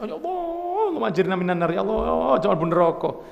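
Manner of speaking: hammed-up high wail to God; mock crying. Preacher imitating ordinary people crying in fear of hell, mock wailing. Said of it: "Nah, ini kan nangis".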